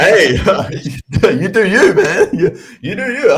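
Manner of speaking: high, loud words through real laughter; cracking up while cheering them on; laughing. Real, not acted. Laughing at a viewer's tattoo offer in chat, high and loud.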